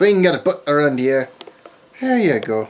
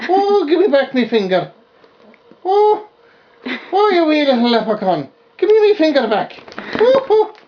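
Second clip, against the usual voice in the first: high, cooing puppy voice; high baby talk; 7 semitones higher than usual. Acted. Puppy chewing her finger.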